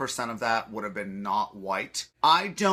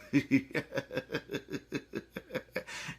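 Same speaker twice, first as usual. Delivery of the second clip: silent wheeze; 8 dB quieter than usual. Retold or imitated. Imitating the man's reaction.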